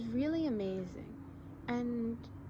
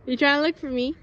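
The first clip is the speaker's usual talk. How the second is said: calling out; 6 semitones higher, 10 dB louder, faster than usual. Real, not acted. Talking to cat below.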